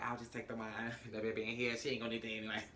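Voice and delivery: low, hushed shrug of a line; quiet, sly aside. Retold or imitated. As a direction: mutter it like a sneaky excuse. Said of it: "Issa was like".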